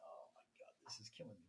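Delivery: breathless whisper, no voice at all; a hushed, strained private aside. Retold or imitated. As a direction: whisper it as if she must not hear. Private aside while she shows legs.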